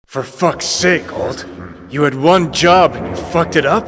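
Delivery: loud, high furious blame; angry accusation. Acted. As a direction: tear into her for failing. Blaming Alt for failure.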